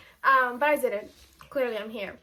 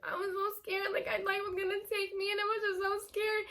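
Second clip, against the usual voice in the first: crying to dad; 6 semitones higher, 7 dB quieter than usual. Retold or imitated. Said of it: "I was like".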